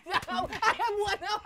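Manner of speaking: giggling through the words; can't stop laughing while answering. Acted. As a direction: servant laughs as his master threatens to tickle him. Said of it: "mày lại cười như là ma làm".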